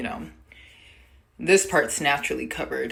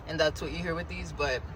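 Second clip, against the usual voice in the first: ordinary talk, nothing marked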